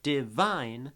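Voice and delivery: divine slowly, loud drawn-out second syllable; stress on second syllable of divine. Shown as a demonstration. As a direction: punch the end of divine, short first syllable. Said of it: "second syllable is the weight".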